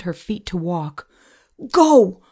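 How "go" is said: loud, high, sudden burst; urgent shouted command. Acted. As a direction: shout to jolt someone into moving. Urging frozen friend to move.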